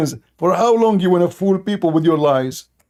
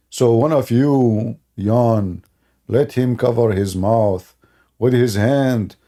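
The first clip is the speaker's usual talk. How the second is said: much lower, slow and weighty; lowered, dragged-out mock sermon voice; mock solemn recital; 7 semitones lower, slower than usual. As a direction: intone the quote like scripture.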